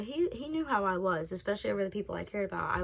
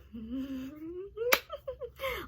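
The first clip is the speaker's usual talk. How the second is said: high, rising maniacal giggle; unhinged high-pitched laughing fit; psychopath laugh; 11 semitones higher, 4 dB quieter than usual. Retold or imitated. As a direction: imitate yourself giggling like a psychopath. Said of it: "I sound like a psychopath, like, laughing".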